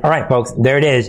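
ordinary talk, nothing marked

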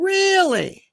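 a shrill-high, overplayed "really?"; mock surprise. Host voicing the judge, hammed-up shocked "really?". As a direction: pretend to be shocked by the news.

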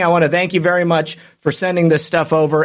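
ordinary delivery

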